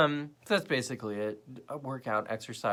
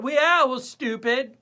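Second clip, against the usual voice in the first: high, louder mock exasperation; mocking retort; 11 semitones higher, 7 dB louder, slower than usual. Fed-up replier answers idiot Twitter, high mocking retort. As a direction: sneer it back in a high voice. Mocks Twitter argument's reply.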